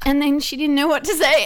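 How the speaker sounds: normal talking voice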